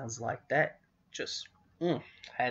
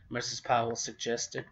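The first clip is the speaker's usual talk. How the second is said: ordinary delivery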